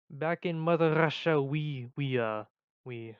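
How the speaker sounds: loud put-on Eastern European accent. Vlogger starting a Mother Russia bit, Eastern European accent. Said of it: "like, Czechoslovakian".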